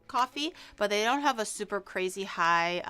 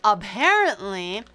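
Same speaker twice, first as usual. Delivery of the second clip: drawn-out doubt; 8 dB louder, slower than usual. Real, not acted.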